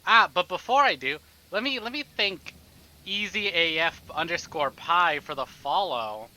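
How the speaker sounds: usual speaking voice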